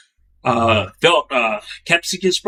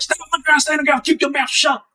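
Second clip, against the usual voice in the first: higher, mock commanding guard voice; imitating barking guards; 11 semitones higher than usual. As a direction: bark orders like a prison guard pinning someone. Guards pinning a prisoner down.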